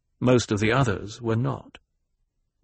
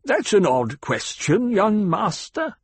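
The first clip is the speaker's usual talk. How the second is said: high, slow banker addressing a youngster; condescending banker; 10 semitones higher, 3 dB louder, slower than usual. Acted. Banker answers the young master at dinner, slow condescension. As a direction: draw it out, pitched up, talking down.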